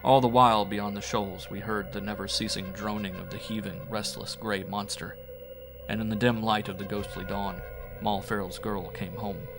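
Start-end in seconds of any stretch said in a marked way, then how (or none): none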